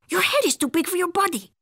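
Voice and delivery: high, quick cartoon voice; exclaiming kitty boy. A narrator's reading. Skipito answering the Martian, exclaiming. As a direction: rattle it off with lively pitch.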